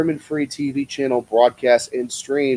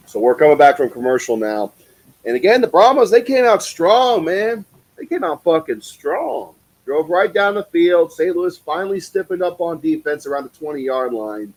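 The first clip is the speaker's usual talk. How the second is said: higher, louder live play-by-play call; 6 semitones higher, 3 dB louder than usual. Acted. Streamer calling the game for chat, live play-by-play. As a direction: call the drive like a sportscaster.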